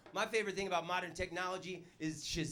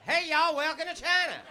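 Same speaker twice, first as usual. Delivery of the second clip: mock-Texan welcome, pitched up; Texan drawl; 7 semitones higher than usual. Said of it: "Chinese people walking around going".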